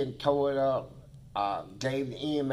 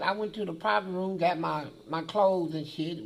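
ordinary talk

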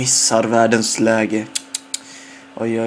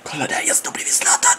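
starstruck whisper; 4 dB quieter than usual. Real, not acted. Starstruck beside Zlatan, hushed.

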